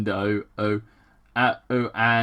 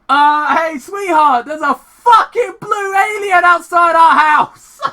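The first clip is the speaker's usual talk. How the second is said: high, loud shrieking panic; panicked scream; 11 dB louder than usual. Retold or imitated. Husband yelling to his wife about an alien, shrieking. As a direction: scream it to your wife in terror.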